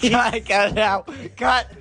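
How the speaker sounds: high mock. Mocking the cut request.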